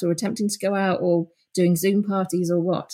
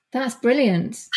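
ordinary talk, nothing marked

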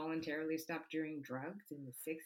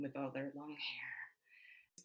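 soft breathy whisper aside; 7 dB quieter, much slower than usual. Real, not acted. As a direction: drop to a whisper on it. Drops to whisper.

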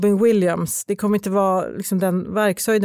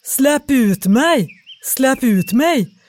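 slow, raised cry for release; trapped spirit pleading from a bottle; calling out; 4 semitones higher, 5 dB louder, much slower than usual. Acted. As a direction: call louder and higher, stretching each word. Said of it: "ropade rösten på nytt".

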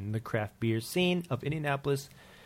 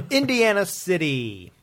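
laughing announcement; 7 semitones higher, 7 dB louder than usual. Acted. Announces beer name laughing.

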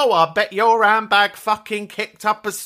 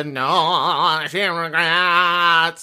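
French singer impression; 2 semitones lower, much slower than usual. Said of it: "I'd give some of her".

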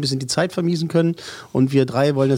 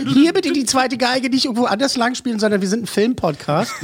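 a hint of a pompous conductor, higher; mock conductor; 8 semitones higher than usual. Teasing co-host as a conductor calling the show back to film, mock pompous. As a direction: lightly play the strict conductor reining in the orchestra. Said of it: "so ist unser [name], der immer sagt so".

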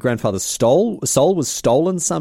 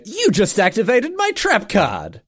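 mock villain catchphrase; 9 semitones higher, slower than usual. Catchphrase quoted as a segment name.

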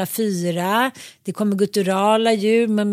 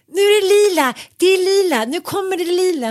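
much higher and louder, thrilled; excited exclaim; 9 semitones higher, 5 dB louder than usual. Retold or imitated. Seeing purple light on the healing table, she calls out excitedly. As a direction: announce the purple like a wonder.

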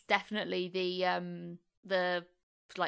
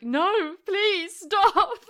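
mock pleading; 8 semitones higher, 7 dB louder, much slower than usual. Voices Crab pleading in the woods.

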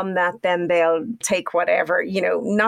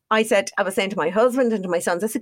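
ordinary talk, nothing marked

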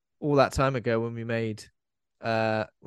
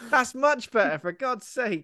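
exasperated; 10 semitones higher than usual. Acted. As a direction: squeal it out in mock exasperation. Repeats line as exasperated outburst.

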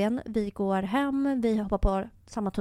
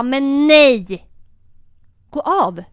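loud, drawn-out refusal, pitched up; deliberately raised voice; 4 semitones higher, 11 dB louder, much slower than usual. Retold or imitated. Woman orders her date off the subway, raised voice. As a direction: shout it so the whole carriage turns to look. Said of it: "jag höjde rösten med mening".

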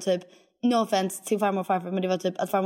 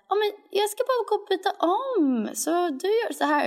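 imitating friend; 11 semitones higher than usual. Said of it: "du var alltid så här".

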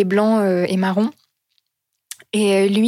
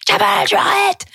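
sharp, much higher mock yell at the horse; teacher yelling at horse; 11 semitones higher than usual. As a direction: bark the command like a riding teacher. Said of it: "elle lui crie dessus". (crier l'ordre au cheval, voix haute et sèche)

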